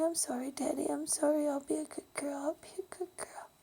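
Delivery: put-on a child whimpering apologies to her father, crying; sobbing plea. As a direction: plead like a terrified little girl through tears. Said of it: "I was bawling my eyes out saying".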